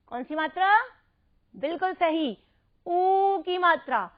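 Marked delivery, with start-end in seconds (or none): 2.9-4.1 ऊ drawn out slowly before की; long ū. Shown as a demonstration. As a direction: hold the vowel ऊ, then finish the phrase. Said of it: "अंतर बिल्कुल ध्यान से बोलकर".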